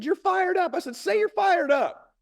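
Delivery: very high, insistent rallying cry; hyping up. Retold or imitated. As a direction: re-enact drilling a phrase into a child. Father hyping up his daughter.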